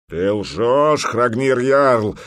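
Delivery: loud, drawn-out roar. A narrator's reading. Giant warrior roaring at the jarl, loud. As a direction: bellow the accusation. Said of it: "взревел здоровяк".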